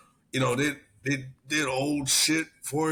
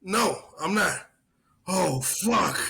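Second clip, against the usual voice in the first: slow, high mock drunk disbelief; drawn-out drunk realising he's late; a retold sergeant realising it's morning; 6 semitones higher, slower than usual.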